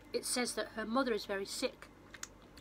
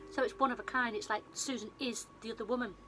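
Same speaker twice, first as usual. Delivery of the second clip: usual speaking voice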